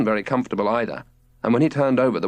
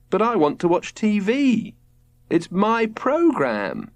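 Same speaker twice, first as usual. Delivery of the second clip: put-on higher, drawn-out childish wail; whiny wail; 10 semitones higher, slower than usual. A narrator's reading. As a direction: whine like a child denied TV. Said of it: "wailed Robert".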